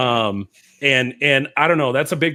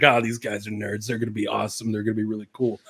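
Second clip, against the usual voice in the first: softer and lower, mock-naive hopeful; naive past self; 5 semitones lower, 5 dB quieter, faster than usual. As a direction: quietly play your younger, gullible self. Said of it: "Like, I came in thinking, like".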